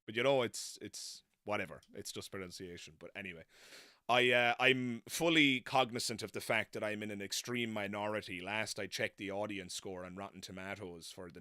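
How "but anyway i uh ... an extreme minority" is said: ordinary delivery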